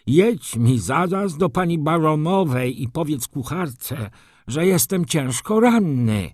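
high, garbled order to a servant; mumbling wounded baron. A narrator's reading. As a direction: give the order loudly through an injured jaw. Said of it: "wybełkotał baron". (wysoki, bełkotliwy głos rannego)

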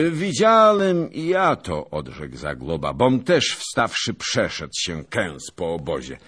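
pompous veteran holding forth at dawn; boastful old noble. A narrator's reading. Zagłoba boasting to his fellow knights, booming and pompous.

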